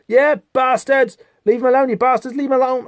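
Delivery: put-on high yelled defiance, no accent; defiant shout. Dane yelling at the hunters to leave his friend alone, defiant shout. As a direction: bellow it at the attackers to leave his mate alone.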